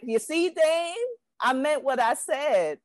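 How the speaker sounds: high, slow taunting voice; mock Paul George. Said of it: "he only signed this to be like".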